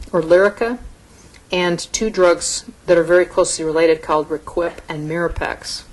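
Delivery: ordinary talk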